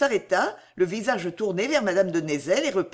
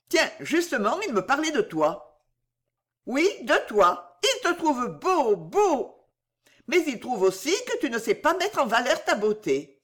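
higher, playful teasing voice; Bijou moqueuse; 5 semitones higher than usual. Acted. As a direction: tease with innocent, high-pitched compliments. Bijou teases Henri. (voix de Bijou, aiguë et taquine)

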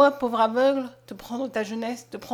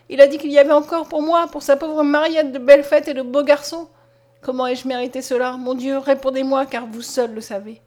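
sobbing reproach; 2 semitones higher, 8 dB louder than usual. A narrator's reading. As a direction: lament loudly, wounded to the heart. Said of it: "répondit en sanglotant Mariette".

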